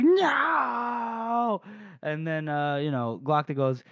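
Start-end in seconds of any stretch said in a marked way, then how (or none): 0.0-1.6 much higher shouted no; a hammed-up despairing shout; mock anguished cry. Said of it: "Salt is like".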